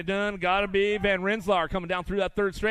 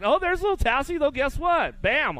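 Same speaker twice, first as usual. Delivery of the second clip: sudden high, louder burst of delight; excited; 10 semitones higher, 5 dB louder than usual. Real, not acted. Announcer thrilled as little Tassi makes the main, excited. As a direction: jump up with joy at the surprise.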